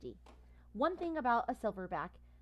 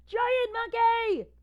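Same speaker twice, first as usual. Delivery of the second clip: high, slow, loud wail; terrified scream; 15 dB louder, much slower than usual. Acted. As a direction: scream it out in panic. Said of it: "screams the orange cat".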